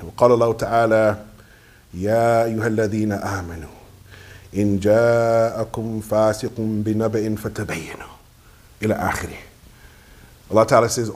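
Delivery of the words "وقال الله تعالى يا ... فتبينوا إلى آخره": measured, sustained liturgical intonation; Arabic sermon recitation. Real, not acted. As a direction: intone the verse solemnly, pausing after each phrase. Opens with Arabic praise and verse.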